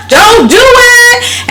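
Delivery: high, loud pleading yell; protesters screaming. Retold or imitated. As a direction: shout it like a desperate protester begging. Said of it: "They was out there screaming".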